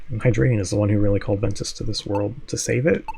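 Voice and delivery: normal talking voice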